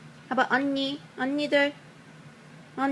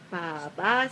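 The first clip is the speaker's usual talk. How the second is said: lower, gentle baas; lightly voiced sheep; 5 semitones lower than usual. Parent reading what the sheep said, low gentle baas. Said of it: "said the sheep".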